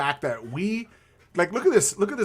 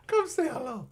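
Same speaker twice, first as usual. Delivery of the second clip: softer high pet voice; 10 semitones higher, 5 dB quieter than usual. Real, not acted. Owner calling the dog again, softer high coaxing voice. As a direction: coax the dog gently in a high voice.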